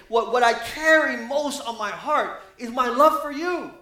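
rising passionate pulpit appeal; impassioned preaching. Acted. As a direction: preach it from the heart at full force. Impassioned preaching at full force.

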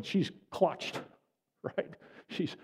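usual speaking voice

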